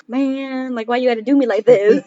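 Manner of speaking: hammed-up kid grievance; mock complaint. Kid protests to sister who took puppy, mock whiny.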